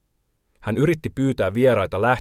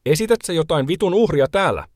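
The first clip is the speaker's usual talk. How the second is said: high, sharp shouted taunt read aloud; shouted question; 8 semitones higher than usual. A narrator's reading. Paula yelling at Timo during her livestream, high shout. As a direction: throw the line up high like a yell. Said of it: "hän huusi".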